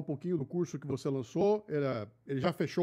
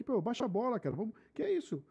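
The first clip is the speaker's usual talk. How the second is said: lightly voiced calm peacemaker, higher pitched; gently mock-pleading to cool things down, pitched up; raised pitch, restrained volume, playful imitation of a conciliator; imitating Bruno; 6 semitones higher, 3 dB quieter than usual. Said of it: "O Bruno é o cara que".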